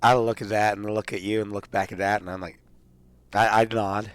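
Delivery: ordinary talk, nothing marked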